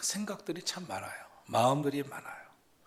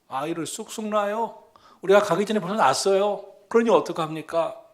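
higher, louder animated pleading; acted midwives' excuse; 6 semitones higher, 7 dB louder than usual. Midwives explain themselves to Pharaoh, as an animated acted excuse. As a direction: plead the excuse with raised pitch.

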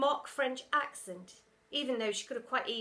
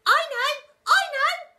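put-on very high, loud eager kid; holler; 14 dB louder, slower than usual. A narrator's reading. Pupil hollering from the crowd, very high. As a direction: shout it out keenly. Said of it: "hollered a voice".

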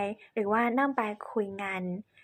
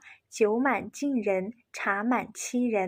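very slow, carefully spoken Chinese saying; slow Chinese proverb; much slower than usual. Shown as a demonstration. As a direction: recite the Chinese proverb slowly. (อ่านสุภาษิตจีนช้าๆ)

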